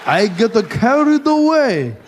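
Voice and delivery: slow, rising English in mock Japanese accent. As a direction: get carried away with the accent. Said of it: "speak English with a Japanese accent now".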